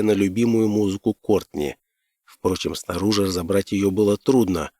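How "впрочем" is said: plain reading voice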